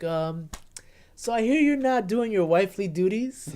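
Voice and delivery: mock High Sparrow. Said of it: "He's like".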